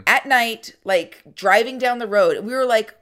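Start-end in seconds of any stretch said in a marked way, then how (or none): none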